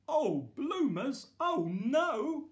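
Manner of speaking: high, loud and slow oh no; dismayed gasp. Acted. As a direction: wail in comic dismay. Discovers his pants are missing.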